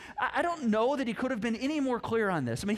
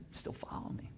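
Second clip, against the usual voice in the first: breathy whispered question, much softer; whispered invitation as Jesus; hushed whisper; 13 dB quieter than usual. Acted. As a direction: lean in and whisper it like a secret.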